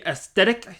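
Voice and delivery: "aesthetic" with an S-T middle, high and questioning; unsure pronunciation. Real, not acted. Man unsure how to say it, tentatively trying "aesthetic" as "es-TET-ick". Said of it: "I don't know how to say it".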